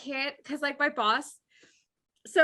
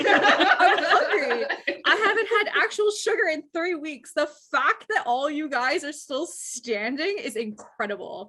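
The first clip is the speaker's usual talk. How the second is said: laughing rant; 4 semitones higher than usual. Retold or imitated. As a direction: drag it out through laughter. Acting a cranky hungry boss.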